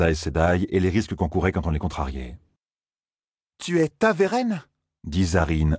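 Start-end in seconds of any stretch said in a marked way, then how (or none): 3.6-4.6 slow, disbelieving question, higher; incredulous. Acted. Zarine to Perrine on hearing he is Taveren, incredulous. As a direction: doubt every syllable of Taveren. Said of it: "incrédule".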